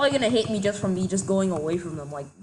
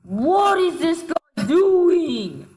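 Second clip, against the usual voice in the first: exasperated yell; 9 semitones higher, 5 dB louder, much slower than usual. Real, not acted. Baffled by enemy player.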